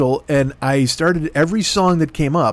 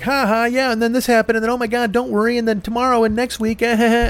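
much higher, breezy babble; mock chatty rundown; 9 semitones higher than usual. Comic mocks a gushing show rundown, high and babbling. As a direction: rattle on like a gushing podcaster. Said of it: "and be like".

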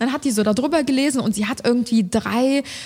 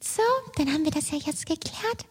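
higher, softer, falsely cheerful colleague; mock sweet voice; 8 semitones higher, 7 dB quieter than usual. Mocking the colleague who yelled, now breezily cheerful. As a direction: chirp it as if nothing happened.

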